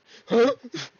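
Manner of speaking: loud, high real laugh; burst of laughter. Real, not acted. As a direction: crack up out loud. Reacts to sprouted wings line.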